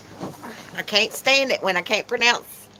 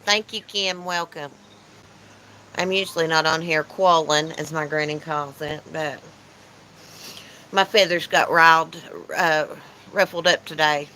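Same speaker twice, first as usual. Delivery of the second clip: ordinary talk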